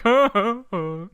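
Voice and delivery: imitated weak crowd chuckle, trailing off; half-hearted laugh. Imitating an uninvested seminar crowd, half-hearted laughing. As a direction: fake a laugh that quickly loses steam. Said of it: "just kinda half-heartedly laughing".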